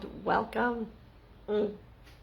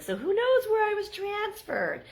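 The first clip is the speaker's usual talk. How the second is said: defensive whine; 3 dB louder, slower than usual. Retold or imitated. Retold defensive reply.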